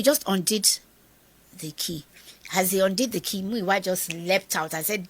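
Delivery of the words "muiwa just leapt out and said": normal talking voice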